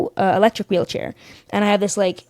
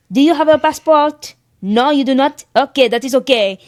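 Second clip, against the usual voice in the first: higher-pitched mock-French official; French accent; 5 semitones higher, 3 dB louder than usual. French customs officer answers her own question, mock French accent. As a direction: imitate a dismissive French officer. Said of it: "Your French accent".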